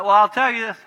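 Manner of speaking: high and loud, heated; escalated voice. Shown as a demonstration. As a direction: let your voice go up in a quarrel. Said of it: "I'll double".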